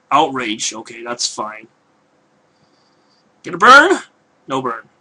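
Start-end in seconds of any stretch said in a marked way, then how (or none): 3.4-4.0 eager high question, hoping hard; hopeful excitement. Real, not acted. Hoping for a burn status. 4.5-4.9 quiet, deflated letdown; disappointed. Real, not acted. The burn did not happen.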